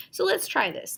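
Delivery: normal talking voice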